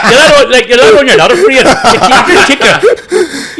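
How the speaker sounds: loud laughing speech; laughing about standing your ground. Real, not acted. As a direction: chuckle through the words. Laughing while answering.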